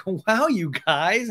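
high, drawn-out put-on wow; mock amazement. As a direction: stretch it out like an impressed friend. Acting Ariana's laughing reaction.

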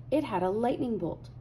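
ordinary delivery, nothing marked

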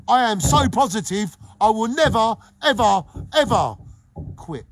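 rising to a loud promise; emphatic vow. Real, not acted. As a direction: swear it with full force. Vowing to stay positive.

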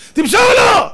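very high, loud shout of pass; football fan yelling pass at the TV, high and loud; fan's yell. Retold or imitated.

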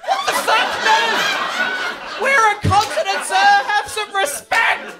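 pretend-insulted shout; indignant outburst. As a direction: demand respect with hammed-up outrage.

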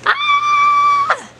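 high-pitched delighted shriek; excited squeal. Real, not acted.

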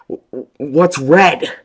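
loud, high, slow stuttering yell; Will yelling in frightened confusion; stammering yell. A narrator's reading. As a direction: yell it out, tripping over the words. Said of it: "Will yelled instantly".

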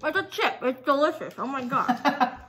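speaking behind hand over mouth; talking while chewing. Real, not acted. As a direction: mouth partly full of chip. Seen 0: Chip bitten at -3; chewing continues, hand covers mouth while speaking.

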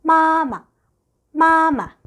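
teacher modelling 마마; Chinese tone demo. Shown as a demonstration. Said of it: "엄마는".

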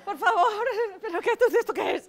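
high, loud, flustered protest; panting, panicky outburst with rising pitch; hyperventilating panic. Acted. Nervous accomplice balking at the absurd disguise, hyperventilating. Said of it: "que estás hiperventilando".

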